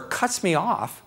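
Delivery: ordinary talk, nothing marked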